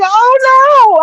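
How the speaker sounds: high, loud, drawn-out wail of alarm; scared cry. Retold or imitated. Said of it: "I was so scared".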